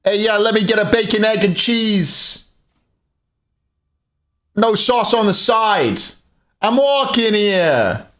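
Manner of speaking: high, brash mock New Yorker; hammed-up New York deli order, very high and drawn out; bad New York accent. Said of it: "I can't do a New York accent".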